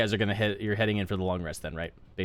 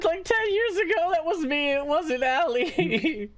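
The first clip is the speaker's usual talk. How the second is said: put-on much higher guilty cat-girl voice, dragging the last words; squeaky, sheepish admission; Gwen confessing; 3 dB louder, slower than usual. Gwen owning up to Friggs, high sheepish voice.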